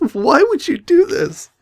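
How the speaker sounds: very high, squeaky complaint; exasperated protest. Real, not acted. Reader blaming whoever wrote the tongue twister, exasperated wail. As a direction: wail it in comic despair.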